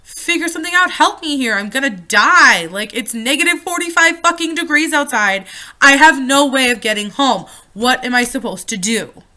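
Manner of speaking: high, loud desperate shouting; panicked yelling. Retold or imitated. Stranded daughter yells at her dad for help, panicked shout. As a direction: scream it at him, stranded and scared.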